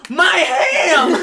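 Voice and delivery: very high, hammed-up wail through laughter; mock outraged cry. Friend sees ham dropped in trash, mock outraged cry. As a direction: yell it like a great loss, laughing.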